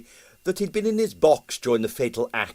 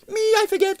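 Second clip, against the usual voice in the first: very high, airy evasion; evasive; 5 dB louder, faster than usual. A narrator's reading. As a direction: dodge the question lightly. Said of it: "said Strogonoff evasively".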